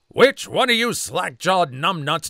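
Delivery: loud scornful demand; mock drill sergeant yell; angry drill sergeant. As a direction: chew out the whole platoon. Drill sergeant yells at platoon.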